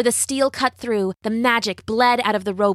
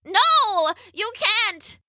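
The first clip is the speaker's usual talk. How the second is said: high, panicked witch's cry; high-pitched desperate protest; 12 semitones higher, much slower than usual. A narrator's reading. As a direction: cry out to stop the escape. Witch cries as Rapunzel escapes.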